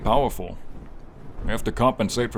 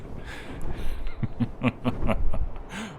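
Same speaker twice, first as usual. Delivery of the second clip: short run of higher chuckles; teasing chuckle; 4 semitones higher than usual. Acted. Dragon to knight pressed against its back, playful chuckle. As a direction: chuckle playfully at a flustered companion.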